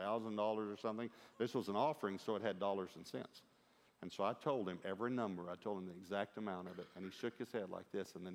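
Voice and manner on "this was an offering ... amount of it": usual speaking voice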